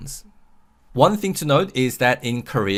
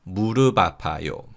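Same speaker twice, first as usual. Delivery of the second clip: quicker linked demo; 3 semitones lower, much faster than usual. Shown as a demonstration. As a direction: say 무릎 아파요 briskly as "mu-reu-ba-pa-yo". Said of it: "So this is read".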